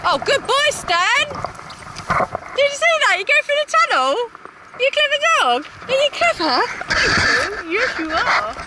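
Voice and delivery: high, delighted praise for a clever dog; excited dog praise. Real, not acted. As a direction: gush over the dog like it's a genius. Praising dog through the tunnel.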